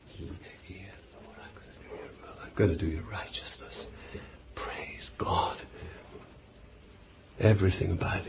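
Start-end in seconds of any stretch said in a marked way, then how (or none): 0.1-5.6 soft, breathy whisper of devotion; whispered vow. Acted. A believer vowing to God in an intimate whisper. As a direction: breathe it like a secret prayer.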